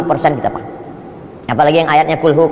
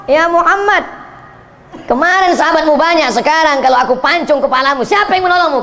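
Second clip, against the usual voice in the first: mock threat; 8 semitones higher than usual. Enemy threatens Nabi with sword.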